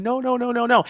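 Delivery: remorseful no. Retold or imitated. Jinx remorseful after shooting him.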